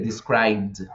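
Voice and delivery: low "described" with no vowel before the D; correct D ending. Shown as a demonstration. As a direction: repeat "described" as a model, ending "bd". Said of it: "solamente decimos".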